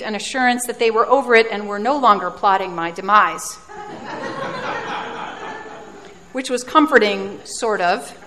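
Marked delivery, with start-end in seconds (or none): 3.6-6.1 breathy laugh. Real, not acted. Laughs about plotted demise.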